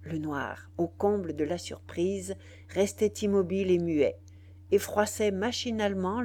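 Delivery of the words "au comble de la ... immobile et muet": ordinary delivery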